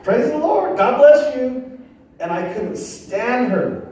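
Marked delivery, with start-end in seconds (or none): 0.0-1.8 much higher, sing-song praise; parrot-like sister. Retold or imitated. Mocking his born-again sister chirping praise like a parrot. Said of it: "She sounded like a parrot".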